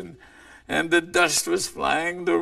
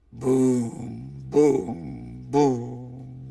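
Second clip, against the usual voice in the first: stomping footsteps; 3 semitones lower, much slower than usual. Acted. Grizzly's heavy footsteps.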